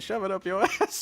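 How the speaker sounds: retelling the blind man's insult, laughing; laughing mock insult. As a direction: spit it out while cracking up.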